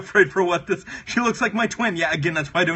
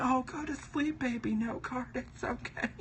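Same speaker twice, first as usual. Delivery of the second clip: much softer hushed lullaby tone; lulling to sleep; 4 semitones higher, 12 dB quieter than usual. Acted. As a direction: comfort a crying baby, hushed. Soothing the crying note card.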